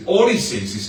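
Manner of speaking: ordinary talk, nothing marked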